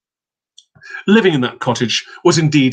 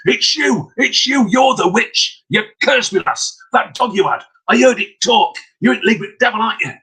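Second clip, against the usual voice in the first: furious accusation; 7 semitones higher than usual. Acted. Peddler accuses the witch.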